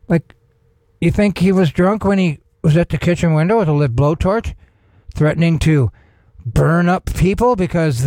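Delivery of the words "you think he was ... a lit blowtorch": ordinary talk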